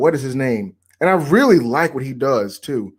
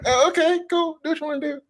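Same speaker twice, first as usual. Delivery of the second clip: mock agreeable; 12 semitones higher, 4 dB quieter than usual. Retold reply to fake therapist.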